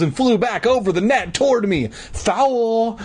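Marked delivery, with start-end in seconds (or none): 2.1-3.0 put-on high girlish call of protest; crying out. A narrator's reading. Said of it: "Mindy cried".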